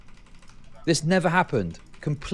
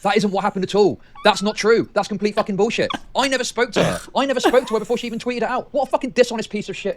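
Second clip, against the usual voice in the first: heated denial; 6 semitones higher, 4 dB louder than usual. Real, not acted. Denying accusation heatedly.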